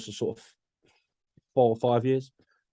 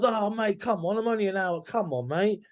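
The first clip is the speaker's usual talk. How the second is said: whiny excuse; 8 semitones higher than usual. Retold or imitated. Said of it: "And they're like".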